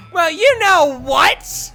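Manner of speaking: loud, high, drawn-out challenge; furious shout. Acted. Natsuki squaring up to Yuri, furious. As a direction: stretch each word into a yell. Acted quarrel escalates.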